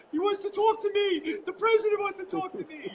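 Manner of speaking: high-pitched thrilled voice, quoting himself; excited squeal. Retold or imitated. Retelling his thrill that the president wants him, in a high excited squeal. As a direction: squeal it like an overexcited kid. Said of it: "honestly, I went".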